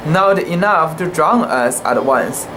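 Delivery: ordinary delivery, nothing marked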